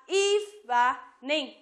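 eve and ning split apart, very slow; syllable by syllable. Shown as a demonstration. Teacher splits evening into two syllables for students. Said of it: "nó sẽ chỉ có hai âm tiết thôi".

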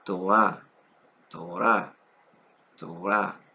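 "Torah" with a light r, stress on the final a; Hebrew word Torah. Shown as a demonstration. Teacher modeling Hebrew Torah for students. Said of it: "es la palabra".

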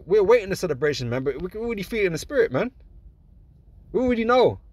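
joyful celebrating preacher; excited rejoicing. Real, not acted. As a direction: lift it up like the victory is already won. Preacher rejoicing over coming victory.

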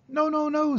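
very high goose squawk; the goose stopping Wilbur, very high; goose protesting. A narrator's reading. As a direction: squawk a warning. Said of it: "said the goose".

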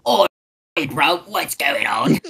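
slow, put-on British lad greeting; British accent. Shown as a demonstration. Showing friends their little British, mock accent. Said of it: "we do a little British".

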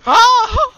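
high, loud shriek of alarm; startled scream. Real, not acted. Gamer ambushed, letting out a high scream. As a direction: shriek in sudden fright.

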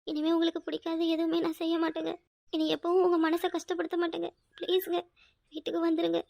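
soft, subdued pleading; hushed pleading apology. Acted. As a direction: plead quietly, almost to yourself. Wife pleads to absent husband. (தாழ்ந்த குரலில் கெஞ்சும் மன்னிப்பு)

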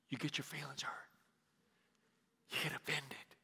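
softly whispered confession. Real, not acted. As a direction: lean in and whisper it. A sensitive point in the sermon.

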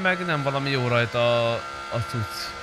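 ordinary talk, nothing marked

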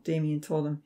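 plain reading voice

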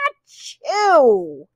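drawn-out theatrical achoo; pretend sneeze. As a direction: let the sneeze burst and trail. The sick cat sneezes.

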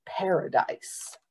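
plain reading voice

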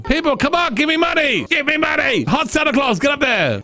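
very high, excited begging; pleading urgently. Pretend. Player begging the bar crowd for money, high urgent plea. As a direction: call out desperately to the crowd.

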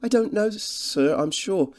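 high, slow, unsure countrywoman; humbly fretful. A narrator's reading. Cottage woman unable to name the Professor, humbly fretful. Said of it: "She spoke in a humbly fretful voice".

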